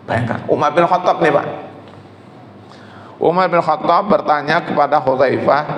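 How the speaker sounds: ordinary talk, nothing marked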